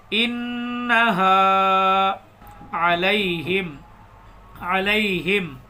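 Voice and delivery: very drawn-out chanted reading; measured tajweed chant; slow recitation. Shown as a demonstration. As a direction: recite with long held vowels.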